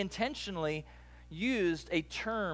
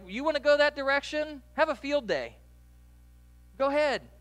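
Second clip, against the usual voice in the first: higher, louder mock permission; sarcastic dismissal; 9 semitones higher, 8 dB louder than usual. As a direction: shrug them off loudly. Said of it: "not be a chaser".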